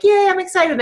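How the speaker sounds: mock excitement. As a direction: chirp it like a fake cheerleader. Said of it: "your tone of your voice is so key".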